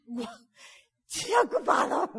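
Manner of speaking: hammed-up relieved friend; mock relief. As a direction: act overjoyed the line is busy. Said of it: "decía".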